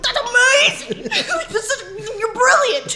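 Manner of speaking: very high, slow laughing gush; gushing amazement. Retold or imitated. Missionary praising a nine-year-old's idea, gushing. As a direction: squeal praise through laughter, hammed up.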